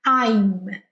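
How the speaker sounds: "I'm" ending on a closed-lip M, lips pressed shut; English I'm, lips closed. Shown as a demonstration. Teacher showing students "I'm" with lips together. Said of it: "encosta os lábios".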